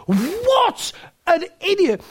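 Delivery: high, drawn-out jeer; slow, incredulous put-down; mock scorn. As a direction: imitate neighbours helpless with derision. Voices neighbours mocking him.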